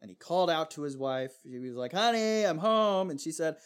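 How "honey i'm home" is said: high, loud cheery call home. Retold or imitated. Husband returns home and calls out to his wife, high and cheery. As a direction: sing it out like a sitcom dad.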